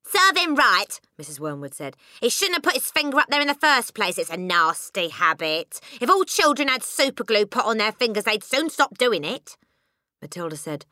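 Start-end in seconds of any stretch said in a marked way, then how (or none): 0.0-1.0 loud, high scornful mother; harsh disapproval. A narrator's reading. Mother harshly judging the boy. 2.2-9.6 high, loud scolding mother; disapproving mother. A narrator's reading.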